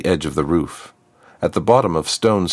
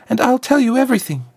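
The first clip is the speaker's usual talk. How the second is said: much higher and fast; urgent trapped girl; faster than usual. Acted. Trapped girl hurries to bargain with Azriakam, high and fast. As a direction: rush out the bargain.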